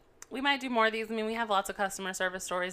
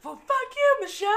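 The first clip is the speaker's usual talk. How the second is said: much higher, louder put-on punchline; mock curse; 6 dB louder than usual. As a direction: throw her name in the joke voice. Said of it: "He's like".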